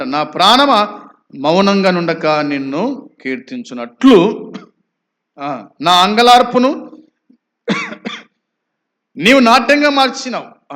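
psalm declaimed, climbing to a high, loud finish; fervent verse proclamation. Acted. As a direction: preach the verse with building force.

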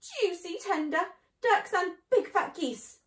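high, loud little-fox relish; eager, greedy cry. A narrator's reading. Small foxes licking their lips, excited and greedy. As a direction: savour it loudly in a high, hungry voice. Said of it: "cried the small foxes, licking their lips".

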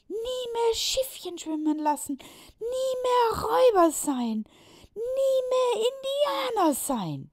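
put-on high, loud, furious children's cry; angry boys. Boys angry about losing the forest, loud high shouting. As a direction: shout out the protest in anger. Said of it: "laut und zornig durcheinander".

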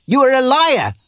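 high, loud scornful accusation; angry retort. A narrator's reading. Miller calling Robin a liar, loud and high. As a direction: fling the accusation back at him.

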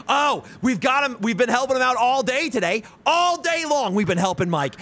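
high, shouty mock-innocent chat. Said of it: "You were like".

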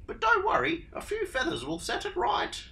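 flutter like a vain bird; fluttering Emu. Acted. Emu greets Dingo, high and fluttery.

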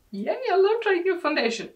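high, loud and quick; excited. Real, not acted. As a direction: burst out with delight. Thrilled by a new foundation.

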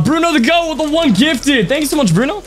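high, bright burst of gratitude; excited thanks. Real, not acted. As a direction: gush a thank-you to the donor. Thanking a donor on stream.

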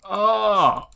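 high, loud drawn-out "oh"; startled cry. Real, not acted. Gamer finds a nasty surprise, startled cry. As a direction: cry out in sudden shock.

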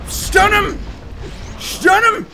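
loud, high shouted command. Real, not acted. Gamer yelling at his weapon, loud shout. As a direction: bark the order at the screen.

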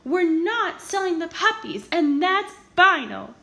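firm loud refusal, slowing at the end; yell. A narrator's reading. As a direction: plant your feet and shout it down. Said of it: "he yelled".

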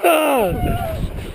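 high distant woo-hoo; excited cheer. Real, not acted. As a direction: whoop from down the hill. Cheer while sledding.

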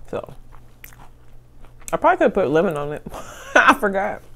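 speaking between bites of food; talking while chewing. Real, not acted. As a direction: mouth partly full of food. Seen 0: holds crab cake, bites at 2.5s, then talks with mouth full.

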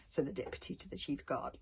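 ordinary delivery, nothing marked

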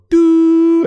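high, loud held "doo"; piercing drawn-out phone tone; imitated phone tone. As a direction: mimic a landline tone, high and sustained. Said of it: "and then it would go".

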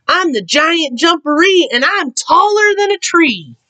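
high-pitched booming boast from the burrow; loud giant voice. A narrator's reading. Hidden Giant Jumperee warning Rabbit, loud voice. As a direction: put on a big scary voice, pitched high. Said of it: "he heard a loud voice".

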